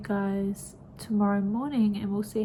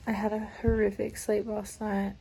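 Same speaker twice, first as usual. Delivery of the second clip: normal talking voice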